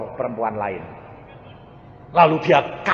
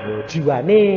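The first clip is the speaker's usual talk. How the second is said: normal talking voice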